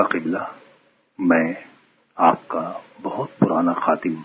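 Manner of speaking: a quiet menacing confession; devil revealing himself. Acted. The wounded stranger tells Father Seman he is Satan, low and sinister.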